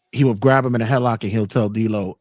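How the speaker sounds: usual speaking voice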